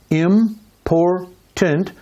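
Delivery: IMPORTANT very slowly, split as im-por-tant; syllable by syllable. Shown as a demonstration. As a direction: break "important" into three separate, slow syllables. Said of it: "three syllables".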